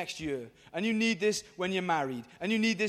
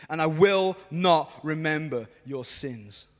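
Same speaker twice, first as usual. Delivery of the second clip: slow, lower-pitched reading of God's words; solemn scripture reading; 6 semitones lower, slower than usual. Acted. Preacher reads God's promise to the crowd, solemnly. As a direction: read it slowly and weightily. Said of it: "This is God talking".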